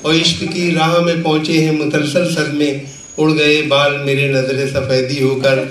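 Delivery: measured, projected Urdu verse recital; comic poet reciting with rhythmic phrasing; mushaira recitation. Acted. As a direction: declaim the couplets to a live audience, line by line.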